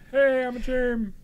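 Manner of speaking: high, slow little germ; cute germ voice. Pretend. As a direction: play a tiny cheerful germ. Speaks as the germ token.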